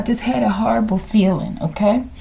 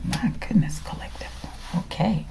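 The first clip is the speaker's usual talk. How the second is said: whisper; 11 dB quieter than usual. Real, not acted. Amazed aside over card.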